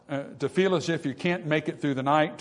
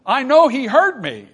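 high, loud cry of conviction; emphatic insistence; 8 semitones higher, 8 dB louder than usual. Acted. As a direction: burst out insisting on it. Psalmist wrestling, insists God heard him.

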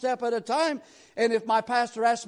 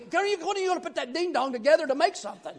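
high, fast mock complaint; pitched-up, rushed exasperation; exasperated listener; 7 semitones higher, faster than usual. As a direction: burst out at the endless practising.

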